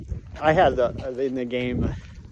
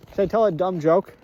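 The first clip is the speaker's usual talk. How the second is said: ordinary delivery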